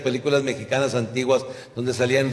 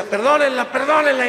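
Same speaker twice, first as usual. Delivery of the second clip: high, louder hammed-up plea; mock pleading; 11 semitones higher, 6 dB louder than usual. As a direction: beg loudly in mock panic. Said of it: "yo le decía".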